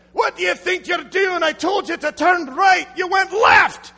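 put-on shouting Scottish accent berating a lost driver; abusive Scottish GPS. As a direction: yell at the driver like an angry Scottish sat-nav. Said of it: "an abusive G P S in a Scottish accent".

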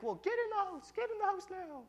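very high-pitched parental order; imitated flustered mother's voice; urgent mom. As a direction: order the kids indoors urgently. Mom orders kids inside.

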